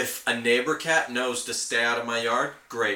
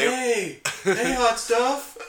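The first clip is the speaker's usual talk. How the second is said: much higher, playful catcall; flirty coyote catcall; 12 semitones higher, much slower than usual. Pretend. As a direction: hollers at the jogger like a cheeky suitor.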